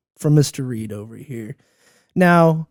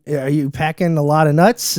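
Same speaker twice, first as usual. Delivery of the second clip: ordinary talk, nothing marked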